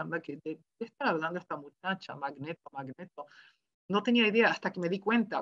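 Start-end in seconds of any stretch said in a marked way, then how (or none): 0.0-3.3 low, hushed wondering to herself; re-enacted private puzzlement, very soft; puzzled muttering. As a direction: mutter it under your breath, baffled. Her puzzled thought, retold.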